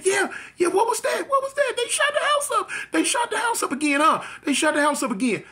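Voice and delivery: very high, frantic wailing voice; imitated crying mother. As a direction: panic in a high-pitched mother's voice. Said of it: "and she crying, she crying".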